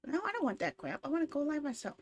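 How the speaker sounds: ordinary delivery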